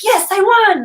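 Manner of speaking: high, loud imitated cheer; triumphant child. Mom quoting her son who won the game, triumphant. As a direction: crow like a kid who just won. Acting out her son's victory.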